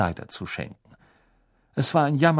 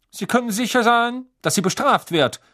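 cool; 13 semitones higher, 6 dB louder than usual. A narrator's reading. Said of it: "fügte Mister Crouch kühl hinzu".

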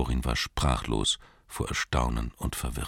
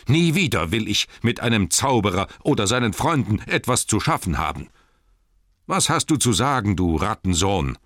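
raised, indignant scolding; angry tirade; 6 semitones higher, 4 dB louder than usual. A narrator's reading. Thorin renounces wizards and challenges Bilbo, angry. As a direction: spit out the demand angrily.

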